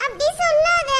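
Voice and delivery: ordinary talk, nothing marked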